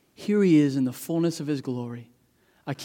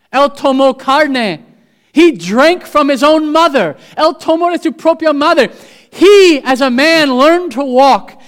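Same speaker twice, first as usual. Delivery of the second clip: high, loud preacher's cry; full-force preaching; 10 semitones higher, 10 dB louder than usual. Acted. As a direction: preach it out to the whole church with fire. Preaching in two languages.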